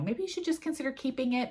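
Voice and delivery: put-on hint at the British viewer without doing the accent; quoting a British commenter. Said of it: "if I could do a British accent here, I would, but I can't".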